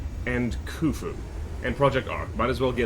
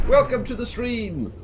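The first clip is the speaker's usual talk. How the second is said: cheery welcome; 10 semitones higher than usual. Real, not acted. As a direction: throw the welcome up high and bright. Welcoming a new chatter.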